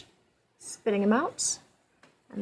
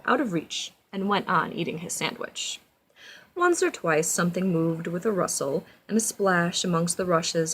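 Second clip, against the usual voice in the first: storybook narration; 3 semitones lower than usual. A narrator's reading. Reads storybook aloud to child.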